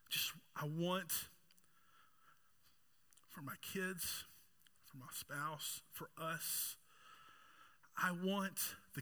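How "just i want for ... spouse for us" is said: near-whisper, soft and aching; hushed ache. Real, not acted. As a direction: let the voice sink, barely there. Aching prayer for family.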